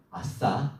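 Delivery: low, soft self-satisfied chuckle; mock smug inner voice, laughing; smug gloating. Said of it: "어떤 마음이 들겠어요".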